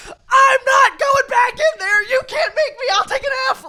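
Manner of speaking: high, loud, slow wailing refusal; crying shout, half laughing; tearful shout. Retold or imitated. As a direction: sob it out as a defiant kid. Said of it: "I'm like".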